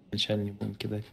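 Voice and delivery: ordinary delivery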